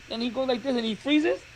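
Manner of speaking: ordinary delivery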